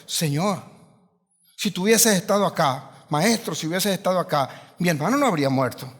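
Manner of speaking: soft, low, sorrowful appeal; a touch of mourning reproach; grieving plea. Retold or imitated. Mary to Jesus over her dead brother, softly grieving. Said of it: "María vino a Jesús y le dijo".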